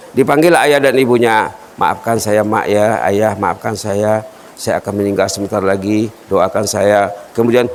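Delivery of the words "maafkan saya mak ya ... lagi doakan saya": dying farewell. Retold or imitated. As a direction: say farewell gently, fading. Dying boy bids parents farewell.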